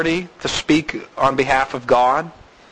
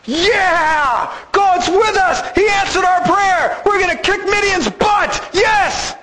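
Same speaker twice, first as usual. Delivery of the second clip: excited cheering; 13 semitones higher, slower than usual. Pretend. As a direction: cheer like you just won. Mock Gideon cheering.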